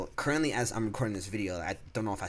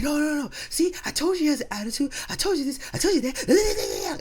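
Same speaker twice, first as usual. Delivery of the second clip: very high, squeaky mocking voice, pitched way up; mocking coworkers; 7 dB louder than usual. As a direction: mimic the nagging coworkers in a shrill, high squeal. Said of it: "Yo, they all were like".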